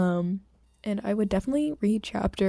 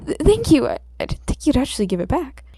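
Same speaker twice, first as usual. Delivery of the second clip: stammering surprise; 6 semitones higher, 5 dB louder than usual. Acted. Startled Izuku stammers thanks.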